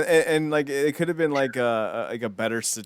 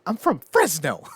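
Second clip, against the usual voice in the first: Ken indignant; 6 semitones higher, 6 dB louder than usual. Pretend. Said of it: "Ken, Ken".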